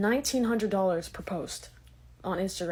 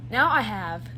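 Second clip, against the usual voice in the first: ordinary talk, nothing marked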